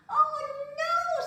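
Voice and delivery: very high, loud wail of dismay; upset princess. Acted. Princess finds her nose gone, high loud wail. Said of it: "really upset".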